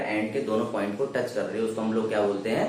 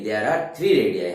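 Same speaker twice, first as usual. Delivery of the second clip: slow dictation; 2 semitones lower, 4 dB louder, much slower than usual. Shown as a demonstration. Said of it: "teacher dictates definition".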